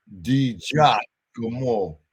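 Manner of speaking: slow teaching take of Di Giacomo, pause after "Gia"; careful pronunciation. Shown as a demonstration. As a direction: Di Giacomo broken slowly as "Dee JAH... ko-mo". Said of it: "That's how you say it".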